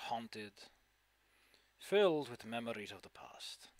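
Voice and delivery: low, hushed sage voice, trailing off; a cryptic old hermit giving directions; mysterious old sage. Acted. GM continues NPC voice.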